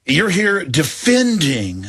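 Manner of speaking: ordinary talk, nothing marked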